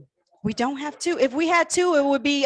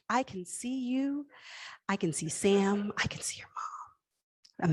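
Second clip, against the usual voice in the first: whisper; 3 semitones lower, 10 dB quieter, slower than usual. Acted. Teasing bidder with imagined Bali trip.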